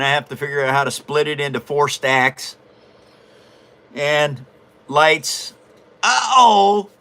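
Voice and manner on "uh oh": loud, higher uh-oh; playful alarm. Real, not acted. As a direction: sound a sudden raised alert. Reacting, then calling Eddie up.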